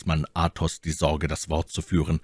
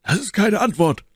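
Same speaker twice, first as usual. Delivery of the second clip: Athos rebuffing; 14 semitones higher, 3 dB louder than usual. A narrator's reading.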